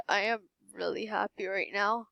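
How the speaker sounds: normal talking voice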